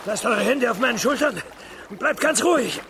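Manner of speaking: high, loud call across the water; urgent call. Acted. Ma Rong shouting to calm the girl in the canal, loud and high. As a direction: shout steady instructions to a drowning girl.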